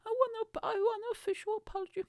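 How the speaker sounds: high laughing voice, a touch fast; laughing while demanding an apology from the chain. Real, not acted. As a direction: giggle through a mock demand.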